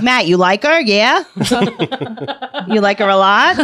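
put-on teasing, laughing Queens accent. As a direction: tease in a thick Queens accent. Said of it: "ceremony in a Queen's accent".

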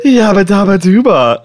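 slow goofy cartoon chant. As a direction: ham it up like a cartoon.

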